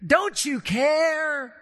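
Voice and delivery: high, loud, slow panicked shout; frantic shout from sinking boat; panicked disciples. Retold or imitated. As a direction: cry out in terror over the storm. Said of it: "freaking out".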